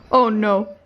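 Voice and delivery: ordinary talk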